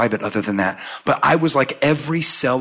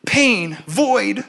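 mock anguish; 9 semitones higher, much slower than usual. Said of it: "I was just like".

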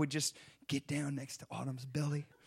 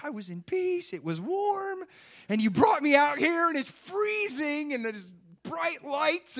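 high, wailing mock-baby protest; hammed-up baby crying out its grievances; crying newborn; 10 semitones higher, 6 dB louder than usual. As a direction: complain loudly like an outraged newborn. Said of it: "he's just crying".

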